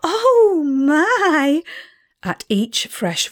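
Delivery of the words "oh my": high, drawn-out gasps of delight; gasping wonder. A narrator's reading. Excited friend unpacking the mysterious picnic packets, gasping in wonder. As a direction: gasp it out, amazed, pitched well up. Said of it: "still gasping".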